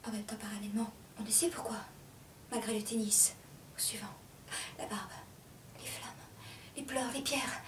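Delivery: barely audible, broken fragments; faint, halting whisper; exhausted whisper. Acted. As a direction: let the words leak out between long pauses. Speaker collapses into fragments.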